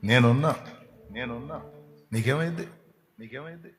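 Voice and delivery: soft reassurance fading to almost nothing; gentle words trailing away quietly; fading soft comfort. Retold or imitated. Soothing distressed person.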